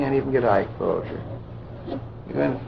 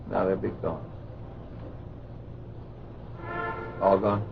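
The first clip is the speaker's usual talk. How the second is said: hypnotic induction; 4 dB quieter than usual. Real, not acted. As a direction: lull the subject down gently. Hypnotist leads trance induction.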